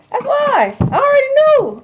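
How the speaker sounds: high, gloating ancestors' voice; smug ancestors. Acted. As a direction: squeal it like a smug know-it-all. Ancestors' voice, knowing.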